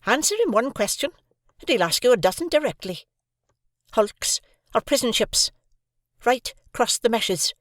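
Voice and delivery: high, stern explaining; sister. A narrator's reading. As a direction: explain impatiently.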